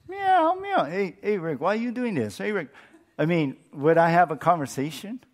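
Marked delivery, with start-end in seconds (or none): 0.1-0.8 high mock meowing; cat meow. Pastor imitating his cat, meowing high. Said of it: "one of our cats talked to me". 0.8-2.7 a hint of a talking cat, slightly higher; a lightly voiced cat pleading with its owner. The pet cat pleading with its owner, lightly higher. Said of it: "one of our cats talked to me".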